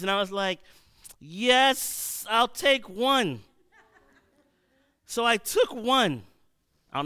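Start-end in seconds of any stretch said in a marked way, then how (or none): 1.2-3.4 eager yes. Retold or imitated. Eager kid grabbing the cookie.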